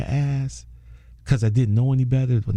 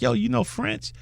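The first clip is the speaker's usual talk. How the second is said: high, softer, delighted mock disbelief; surprised question; 10 semitones higher, 5 dB quieter than usual. Vagrant thrilled to find a French speaker, high mock surprise. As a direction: ask it like you can't believe your luck.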